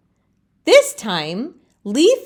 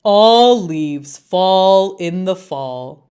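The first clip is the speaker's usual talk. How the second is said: slow, louder chorus of leaves; know-it-all leaves; 5 dB louder, slower than usual. Acted. Other leaves scold Leaf, slow and loud. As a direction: lecture it slowly like smug elders. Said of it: "the other leaves say".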